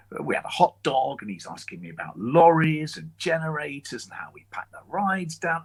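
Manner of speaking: usual speaking voice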